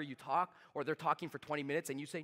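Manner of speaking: normal talking voice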